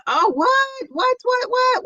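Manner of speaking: high, startled what; real shock, high; shocked disbelief. Real, not acted. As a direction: squeal out the disbelief. Shocked at a comment.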